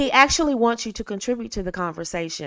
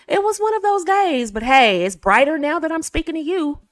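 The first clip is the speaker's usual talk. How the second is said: much higher, bright, turning a bad day positive; upbeat despite a bad day; 8 semitones higher, 4 dB louder than usual. Shown as a demonstration. Woman cheering up on the phone with a man, bright and upbeat. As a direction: make a bad day sound cheerful for him. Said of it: "Or if it wasn't good".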